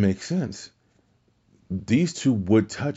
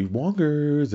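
put-on drunk woman voice; 8 semitones higher than usual. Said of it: "having the drunk chick say".

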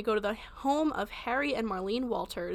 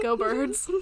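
ordinary talk, nothing marked

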